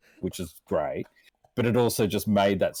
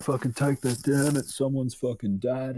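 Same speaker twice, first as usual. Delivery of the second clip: higher, lightly voiced angry commenter; a hint of a huffy complainer, pitched up; mock outraged messager; 5 semitones higher than usual. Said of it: "people message me just going".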